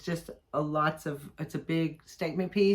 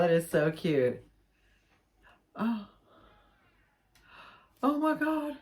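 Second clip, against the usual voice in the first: ordinary talk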